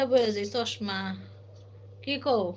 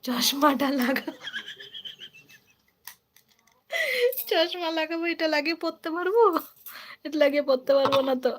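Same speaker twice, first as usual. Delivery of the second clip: reading a viewer's line a bit higher; lightly put-on higher voice, speeding up at the end; fond, lightly voiced impression of a viewer fussing over glasses; playful voicing; 6 semitones higher than usual. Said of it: "স্বরূপ বলছে".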